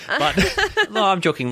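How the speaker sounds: high laugh, then laughing no, I'm joking. Real, not acted. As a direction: laugh and take it back. Takes back joke about Tasmania.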